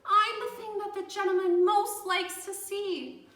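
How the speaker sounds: high, loud delighted cry; thrilled. A narrator's reading. Edward realising the visitor loves emus, thrilled and high. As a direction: burst with joy.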